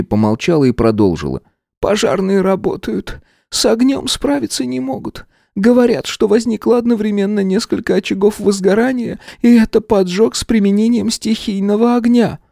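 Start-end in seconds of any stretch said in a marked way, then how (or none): 1.8-12.4 high, strained woman's report; a distressed manager explaining; worried director. A narrator's reading. (встревоженная директриса)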